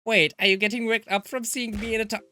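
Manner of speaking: loud, indignant telling-off; angry girl scolding a half-dressed friend. Acted. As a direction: scold her for strutting around half naked. Angry chosen option, scolding.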